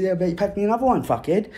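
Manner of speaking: smug order. Retold or imitated. Teen ordering the bully to pack a bong. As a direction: boss him around, smug.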